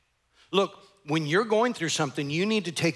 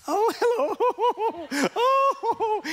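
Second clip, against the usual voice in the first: very high, drawn-out sing-song greeting; hammed-up sugary squeal; fake church cheer; 3 dB louder, much slower than usual. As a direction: put on a gushing, laughing church hello. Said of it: "suddenly they're church face".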